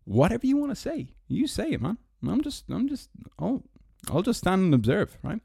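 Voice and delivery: high, airy mock-innocent shrug; sarcastic falsetto. Shrugging off the quote for viewers, high sarcastic tone. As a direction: pretend you're just an innocent bystander.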